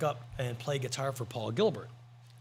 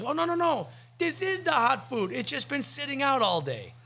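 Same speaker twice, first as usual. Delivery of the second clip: put-on high, bright Korean accent; hammed-up Korean waiter accent; 10 semitones higher than usual. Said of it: "I love your Korean accent".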